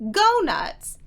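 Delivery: excited go nuts. Real, not acted. Reacting to the book.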